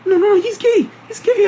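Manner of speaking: loud, squeaky falsetto insistence; mock frantic pleading. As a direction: imitate her panicked begging, very high. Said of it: "pleading with me to believe".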